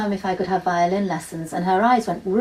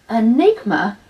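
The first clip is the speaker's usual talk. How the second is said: gasping surprise; 3 semitones higher, 8 dB louder, slower than usual. A narrator's reading. Child gasps at the name.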